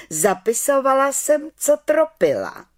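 high, forceful aunt voice; accusing aunt. A narrator's reading. Aunt tells the council of her spying, accusing. Aunt denounces the little witch.

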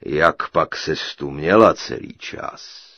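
low, loud, slow giant voice; booming giant. A narrator's reading. Giant questions his captive bride, low slow boom. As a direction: draw it out like a huge giant.

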